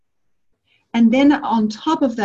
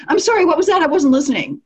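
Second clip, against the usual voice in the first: distracted listener; 8 semitones higher, 4 dB louder than usual. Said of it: "the other person said".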